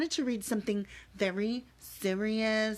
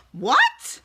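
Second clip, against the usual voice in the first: mock shock; 9 dB louder than usual.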